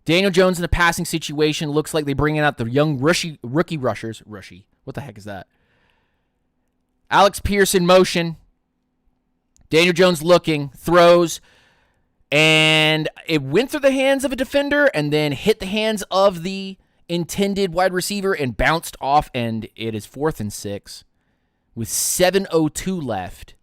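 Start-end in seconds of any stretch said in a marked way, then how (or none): 4.2-5.5 much softer, lower aside; muttered self-mockery. Real, not acted. Streamer questioning his own flubbed word, muttered aside. 7.1-19.3 raised, louder call of the pass; live play-by-play. Real, not acted. Streamer calling a deflected pass for viewers, live play-by-play.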